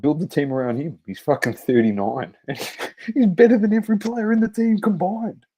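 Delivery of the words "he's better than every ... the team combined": much higher, louder excited boast; hyped exclamation. Real, not acted. Fan hyping Ronaldo, high and excited. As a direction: overstate it with a grin in the voice.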